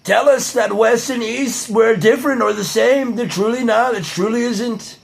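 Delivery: high, drawn-out politician's boast; Donald Trump impression. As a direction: raise the pitch and stretch the phrase endings. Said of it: "practicing my Donald Trump impression".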